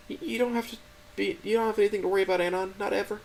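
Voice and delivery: put-on a crying girlfriend pleading to help; high, gentle, tearful voice; tearful Stella. A narrator's reading. Stella's voice in the story.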